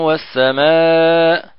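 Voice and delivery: والسماء read slowly with no nasal hum; without ghunna. Shown as a demonstration. Teacher shows the reading that is not idgham. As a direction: recite والسماء long but not nasal. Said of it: "فهذا ليس بإدغام".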